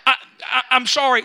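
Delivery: loud, worked-up apology; real excitement spilling into an apology; excited. Real, not acted. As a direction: still fired up from preaching. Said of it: "I get excited about this".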